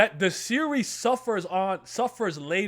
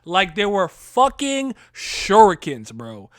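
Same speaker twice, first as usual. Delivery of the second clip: louder, slow excited emphasis; hyped; 9 dB louder, slower than usual. Real, not acted. Host hyping up the finale, loud excited stress. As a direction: lean into every word with hype.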